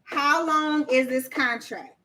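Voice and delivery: slow repeated quiz question; quizzing slowly. Shown as a demonstration. Host repeating the question for chat, slow. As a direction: stretch it out and let them think. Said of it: "pop quiz".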